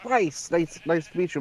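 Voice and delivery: lightly impersonated friendly greeting; Richard Horvitz. Retold or imitated. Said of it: "a really good Richard Horvitz impression".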